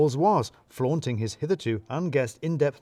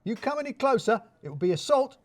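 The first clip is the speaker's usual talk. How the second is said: backing away in a fluster; hysterical; 11 semitones higher than usual. A narrator's reading. Boss fends off Radu, hysterical higher voice. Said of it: "becoming hysterical".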